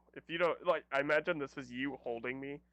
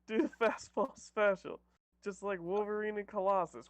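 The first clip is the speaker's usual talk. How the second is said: heroic battle call; 6 semitones higher than usual. Acted. Player retakes the team attack call, heroic battle cry. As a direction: call out the special move grandly.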